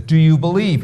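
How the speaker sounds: mock-commanding heavenly challenge; booming voice from heaven. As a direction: boom it out like a voice from above. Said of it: "suddenly booms back and says".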